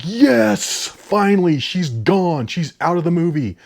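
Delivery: high cheer settling into relief; relieved cheer. Retold or imitated. Reviewer recalls the hated character getting blown up, relieved cheer. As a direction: retell a triumphant cheer, starting high. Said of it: "I actually cheered out loud".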